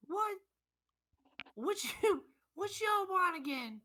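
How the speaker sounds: put-on high, loud grumpy Southern old man; cranky old shopkeeper. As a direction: bark at the adventurers like a fed-up old codger.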